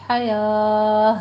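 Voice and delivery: حیا with the final ha breathed out, drawn very slowly; teacher stretching حیا to let the ha sound come out; pausal ha ending. Shown as a demonstration. Quran teacher showing pause on حیا for her students. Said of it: "وقف ابدال کرنا ہے".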